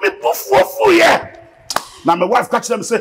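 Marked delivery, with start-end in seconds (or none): 0.0-1.4 mock poof. Mock sound of surprise.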